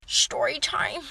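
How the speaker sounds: slow, stretched half-whisper; soft, drawn-out storyteller's tease; hushed title tag. Pretend. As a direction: softly draw out the catchphrase.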